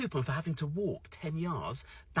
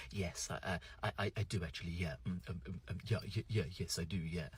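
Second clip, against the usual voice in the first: low, very soft, stammering English charm; Hugh Grant; 7 semitones lower, 9 dB quieter than usual. Retold or imitated. Flustered Brit answering the sweet server, low, soft, stammering. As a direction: bumble and stutter like a flustered English gentleman. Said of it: "I suddenly became Hugh Grant".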